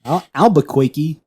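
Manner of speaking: Albuquerque in a Brooklyn cartoon accent; Bugs Bunny accent. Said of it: "that's Bugs Bunny's accent".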